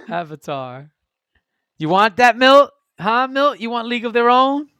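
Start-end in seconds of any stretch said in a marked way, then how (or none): 1.8-4.7 loud, high playful taunt; teasing. Real, not acted. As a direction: needle him, loud and playful. Teasing friend over his vote.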